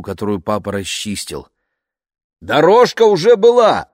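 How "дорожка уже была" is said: loud, high shout over noise; shouting over engine. A narrator's reading. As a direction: yell it above the roaring motor. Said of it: "перекрикивая вой мотора".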